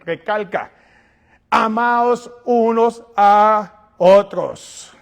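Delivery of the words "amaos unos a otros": very slow, loud and drawn out, word by word; slow with pauses. Shown as a demonstration. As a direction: stretch each word with pauses between. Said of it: "Pero no pase pausa".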